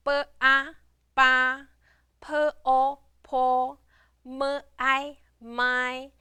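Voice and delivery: each part separately, then blended: "p, o, po", "m, I, my"; teacher modelling "B, a, ba" in even, measured chunks; blending syllables. Shown as a demonstration. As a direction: sound out "B, a", then join as "ba", on a level pitch. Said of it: "we put them together".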